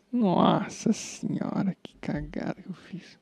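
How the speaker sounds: slow, drawn-out gasp of dismay, sinking to near whisper; hushed "oh no" at your own blunder; dismayed self-reproach. Real, not acted. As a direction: start high and stretched, then trail away very softly. Reacting to his own coding blunder.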